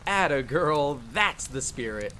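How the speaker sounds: loud, hearty encouragement; punched-up attaboy energy; teasing cheer. Acted. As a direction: cheer your friend on playfully. Cheers on the reluctant teammate.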